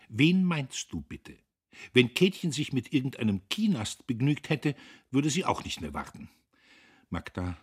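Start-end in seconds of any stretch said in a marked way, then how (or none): 0.1-6.3 pitched up and louder, challenging her sister; raised-voice retort; sharp challenge. Acted. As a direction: take her to task indignantly. Emmy challenges Magda in a quarrel.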